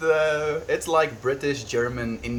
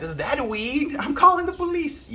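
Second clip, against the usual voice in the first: very high, squeaky mock alarm; high-pitched put-on busybody; mock scandalised onlooker. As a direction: pretend to be shocked and tattling.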